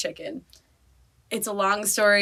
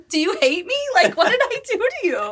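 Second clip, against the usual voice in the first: high, whiny, wounded plea; mock hurt; 9 semitones higher than usual. Dog mom to dog going to bed alone, mock hurt. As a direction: act rejected, voice climbing high.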